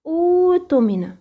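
slow drawn-out أوتمنة with long initial u; tajwid demo. Shown as a demonstration. Teacher demonstrates the madd in أوتمنة slowly. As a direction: recite أوتمنة stretching the madd. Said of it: "نفس حركة أو جنس حركة همزة الوصل".

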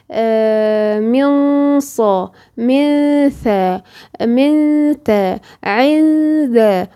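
each syllable drawn out very slowly with a long held nasal hum; nasal ghunna demo. Shown as a demonstration. Teacher showing her student how the hidden noon sounds, drawn-out nasal ghunna. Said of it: "هكذا".

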